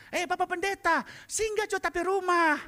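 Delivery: high, lively call to the pastor; Manado woman calling. Retold or imitated. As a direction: invite him in like a friendly local lady. (ibu Manado memanggil pendeta dengan suara tinggi)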